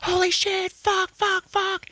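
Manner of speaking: hammed-up terrified shrieking; mock screaming panic. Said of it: "they're all".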